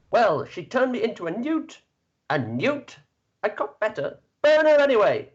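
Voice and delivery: high villager rising to a loud shout; peasant crowd voice. Acted. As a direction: build from sheepish to bloodthirsty. Villager answers the knight.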